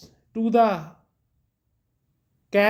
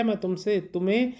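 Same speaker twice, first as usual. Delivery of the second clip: ordinary talk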